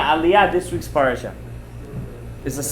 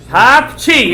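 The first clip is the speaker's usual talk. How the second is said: loud mock sneeze, higher pitch; fake sneeze; 5 semitones higher, 6 dB louder than usual. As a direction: burst out a pretend sneeze. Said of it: "he wouldn't actually do a sneeze".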